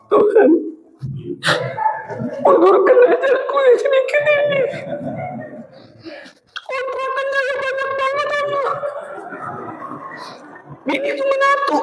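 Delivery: high, soft pleading voice; pleading dying man. Acted. Dying man begging God, high and hushed.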